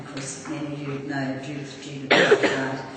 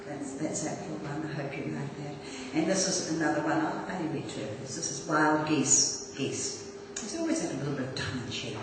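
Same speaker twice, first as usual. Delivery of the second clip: ordinary talk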